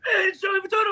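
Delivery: very high-pitched excited holler; imitated passer-by call. Man retelling a stranger spotting him from the street, calling out.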